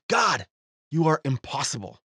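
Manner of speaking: normal talking voice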